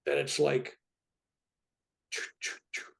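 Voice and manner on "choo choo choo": whispered choo choo of a charging machine; imitated defibrillator charge. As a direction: breathe out a voiceless mock machine charge.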